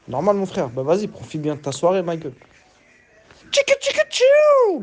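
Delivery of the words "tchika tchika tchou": mock scratch sounds. Beatbox-like intro sounds.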